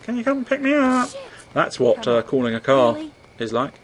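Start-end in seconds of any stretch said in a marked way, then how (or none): 0.0-1.1 put-on high-pitched whiny kid; mock teen on phone. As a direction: plead like a teen to a parent. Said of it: "people calling their fathers".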